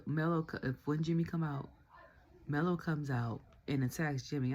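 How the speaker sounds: ordinary delivery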